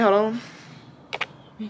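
ordinary talk, nothing marked

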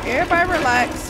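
high, loud, quick order; urgent command. Real, not acted. Player telling game figures to relax, urgent shout. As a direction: raise the voice to make them settle.

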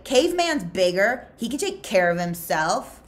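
egging on. Acted. X-Ray egging on.

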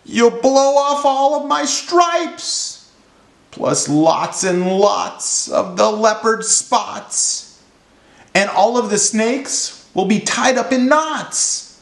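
high, slow alarmed zebra; yelling zebra. A narrator's reading. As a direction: yelp it in a high panicky voice. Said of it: "The zebra yelled".

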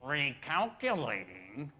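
slow, sugary GPS lady; sweet lady's voice. Retold or imitated. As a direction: draw it out sweetly with a lilting rise. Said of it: "sweet, loving lady's voice say".

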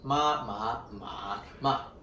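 "ma, ma, ma, ma" repeated with the four Mandarin tone contours; four Mandarin tones again. Shown as a demonstration. Repeating the tone demo for the host, four tones of ma. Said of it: "Ma puede ser".

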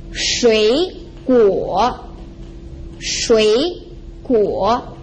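shui guo slowly, with dipping, rising syllables; falling-rising tones. Shown as a demonstration. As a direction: separate shui guo, each lifting at the end. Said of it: "a falling and rising tone".

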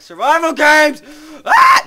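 loud frustrated outburst; angry outburst. Real, not acted. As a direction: blurt out a loud rant. Mock rage at server.

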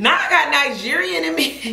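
laughing it out, high; laughing excitedly. Real, not acted. Vlogger laughing over DNA results. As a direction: giggle through the news.

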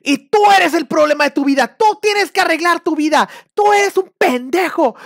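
high, loud, slow hammed-up yelling; mock coach yelling. Coach yelling at a student to break him down, mock shout. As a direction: bark insults like a cult coach.

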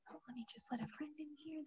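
hushed, quick whispered aside; real off-hand whisper, very soft; quiet aside. Real, not acted. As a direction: mumble to yourself while busy. Aside while admitting someone.

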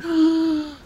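high, drawn-in gasp; sharp gasp. Real, not acted. Player alarmed near the edge, high gasp.

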